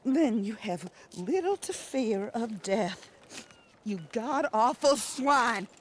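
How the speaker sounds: scornful widow cursing him slowly; contemptuous insult. Acted. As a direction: drag out each word with contempt.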